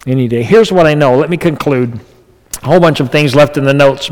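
normal talking voice

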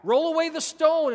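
loud, high proclamation; preacher voicing a forceful command; Jesus commanding. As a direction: ring it out as an order. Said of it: "But Jesus says".

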